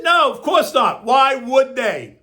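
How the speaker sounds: hammed-up dismissive politician; mock quote of Joey. Mocking Joey's press conference answer, hammed-up and drawn out. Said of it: "he said".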